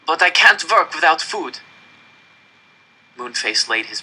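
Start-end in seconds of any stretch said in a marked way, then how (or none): 0.1-1.6 put-on high, urgent boy's plea; pleading. A narrator's reading. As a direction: press the case, voice raised. Boy pleads with Moonface.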